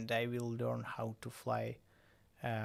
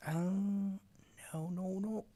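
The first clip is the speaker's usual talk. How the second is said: high-pitched dismayed "oh no"; alarmed; 7 semitones higher, slower than usual. Real, not acted. Pilot losing control of the drone, alarmed. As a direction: voice jumps up in real alarm. Drone going wrong in game.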